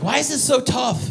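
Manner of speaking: high, complaining question; exasperated. Acted. Someone alone wrestling a duvet cover, exasperated.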